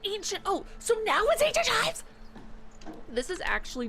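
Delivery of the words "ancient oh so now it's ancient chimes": much higher and louder, building to a shout; incredulous outrage. Real, not acted. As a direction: explode at the item name. Ranting at the game.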